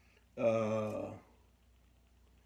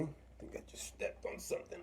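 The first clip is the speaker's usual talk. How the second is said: sudden whisper; 6 semitones higher, 9 dB quieter than usual. Real, not acted. Startled after stepping on something.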